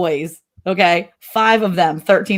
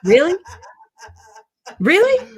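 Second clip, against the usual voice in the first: high, rising disbelief; mock-astonished mom challenge; incredulous mom; 12 semitones higher than usual. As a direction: question it twice, pitching way up the second time. Mother challenges boys' answer.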